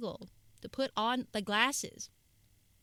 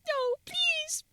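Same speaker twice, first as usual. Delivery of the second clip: high loud crying plea; pleading teary voice; 8 dB louder, slower than usual. Pretend. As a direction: beg tearfully not to be hit. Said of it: "And just be like".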